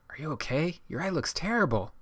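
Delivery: high, anxious exclaiming; worried exclaim. Acted. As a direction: blurt it out in alarm. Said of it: "Nico exclaimed".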